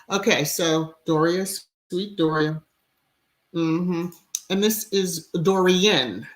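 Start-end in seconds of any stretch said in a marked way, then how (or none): none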